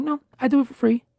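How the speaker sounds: high-pitched imitation of a friend; imitated co-host. Host retells co-host turning down pay, high imitated voice. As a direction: pitch it way up, mimicking their reply. Acts out co-host's answer.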